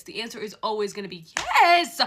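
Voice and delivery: loud, stressed yes; emphatic. Real, not acted. Fan insisting to viewers, loud emphatic. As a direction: declare it. Repeats louder.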